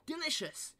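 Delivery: high delighted cry. A narrator's reading. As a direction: savour it with joy. Tasting perfect porridge.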